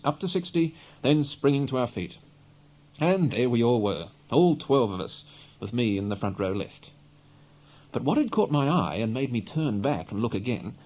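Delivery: usual speaking voice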